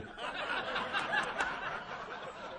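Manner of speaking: high breathless laugh; real laugh. Real, not acted. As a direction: burst out laughing at the joke. Laughing at wife's joke.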